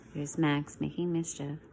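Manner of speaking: ordinary delivery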